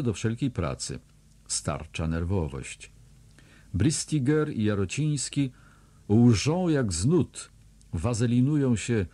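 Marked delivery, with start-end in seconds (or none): none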